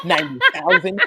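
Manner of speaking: retold scoff with a laugh in it. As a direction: mimic a wife's laughing disbelief. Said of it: "she kinda scoffed at it".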